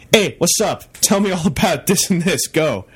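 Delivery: upbeat, lively imitation of fan chatter; energetic. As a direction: toss it out like an eager fan. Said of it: "it's energetic and it's fun".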